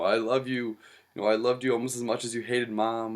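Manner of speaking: lightly voiced grieving son joking; jokey son. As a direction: play the son cracking a joke at the deathbed. Son's jokey words to dead father.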